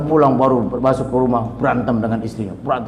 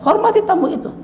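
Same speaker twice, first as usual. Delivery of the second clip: sharply raised, pressing exhortation; emphatic command. Real, not acted. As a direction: drive the command home high. Preacher urging listeners. (seruan tegas bernada tinggi)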